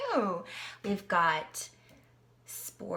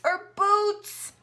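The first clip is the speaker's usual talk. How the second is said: excited squeal; 9 semitones higher, 9 dB louder than usual. Acted. Playful reading to child.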